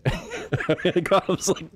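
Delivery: slow, high words stretched by laughter; laughing through words. Real, not acted. Reacting to the woman's threat on the show, cracking up. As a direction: try to speak while cracking up.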